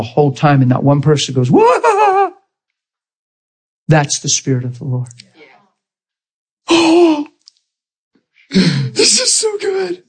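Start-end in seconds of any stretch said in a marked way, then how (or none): 1.5-2.3 high-pitched drawn-out whoa; mock astonishment, way up high; amazed whoa. Mimics an amazed reaction in story. 6.7-7.2 high, drawn-out gasp of wonder; long awestruck intake; amazed gasp. Acted. Reacting in wonder to own story. 8.5-10.0 very high, slow squeal of delight; real high-pitched, drawn-out delight; overjoyed. Real, not acted. Overcome with delight at own story.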